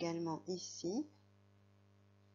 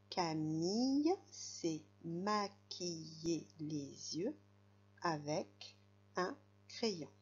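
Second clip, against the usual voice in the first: slow, careful model reading for a beginner; very slow reading aloud; 2 semitones lower, slower than usual. Shown as a demonstration. Teacher to young reader, reading the sentence very slowly. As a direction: read Camille s'est maquillée les yeux word by word, very slowly. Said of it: "ce sera mon tour".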